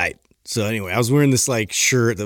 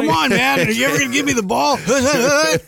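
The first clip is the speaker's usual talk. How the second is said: high, squealing eager player voice; overexcited quarterback calling for the ball; super excited; 14 semitones higher than usual. Retold or imitated. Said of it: "The guy's just getting, like, super excited".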